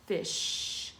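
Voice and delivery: "Fish" with the final sh hissed long and breathy; sh sound drawn out. Shown as a demonstration. Teacher to class, hissing the sh in "Fish". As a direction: stretch the sh at the end of "Fish". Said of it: "Did you hear the sound that is at the end of fish".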